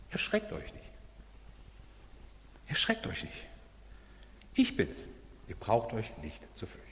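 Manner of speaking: soft, near-whispered calming words; hushed reassurance. Acted. Jesus reassuring the frightened disciples in the boat, hushed and soft. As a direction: hush them gently, as Jesus soothing.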